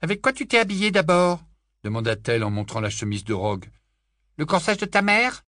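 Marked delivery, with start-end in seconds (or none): 0.0-1.4 high, loud sneering jibe; mocking. A narrator's reading. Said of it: "trouver quelque chose de blessant à dire". 4.4-5.4 high, loud sneering jibe; mocking. A narrator's reading. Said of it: "quelque chose de blessant".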